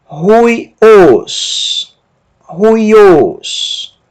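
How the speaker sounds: "Ruios" begun with h, as huiós; slow model "Ruios" with breathed h and long final syllable; strong aspiration. Shown as a demonstration. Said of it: "a presença da aspiração forte". ("Ruios" como huiós, com aspiração forte)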